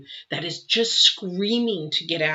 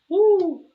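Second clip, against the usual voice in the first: 1 emotional woo; 13 semitones higher, 8 dB louder than usual. Real, not acted. Moved by spirit, emotional cry.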